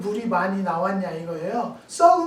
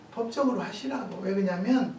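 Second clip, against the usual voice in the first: usual speaking voice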